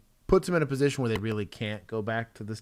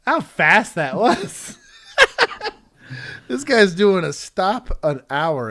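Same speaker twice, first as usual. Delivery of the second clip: laughing disbelief; 8 semitones higher, 11 dB louder, slower than usual. Real, not acted. Reacting to a rapid traffic stop.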